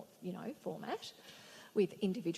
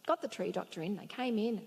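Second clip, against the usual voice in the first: ordinary delivery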